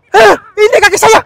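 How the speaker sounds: very high, loud shriek "ini kaki saya!"; terrified shriek. Real, not acted. Explorer screaming to his friend as the creature touches his leg, panicked. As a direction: scream it as something grabs your leg.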